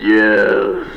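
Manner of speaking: big voice of God. Acted. God answers man on the cliff, big deep voice. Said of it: "there was this big voice".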